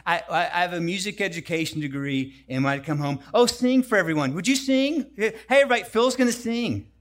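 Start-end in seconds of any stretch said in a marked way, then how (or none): 3.3-6.9 higher, louder proud gushing; proud mom calling out. Retold or imitated. Mom proudly shows off her son to the guests, loud and excited. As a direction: announce him to the room like a proud mom. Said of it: "but my mom".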